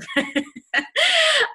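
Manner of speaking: real high burst of laughter; high-pitched laugh. Real, not acted. Laughing at her own accent, high helpless laugh.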